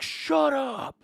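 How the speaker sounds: high, sharp snapped order; snapping guard. Acted. Guard snapping at the cheering prisoners, sharp high order. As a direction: cut the prisoners off. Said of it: "the guard's like".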